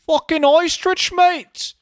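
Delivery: hammed-up UK accent yell; mock British yell. Shown as a demonstration. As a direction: shout it like a rowdy Brit. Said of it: "I'm trying to say it like you guys in the U K".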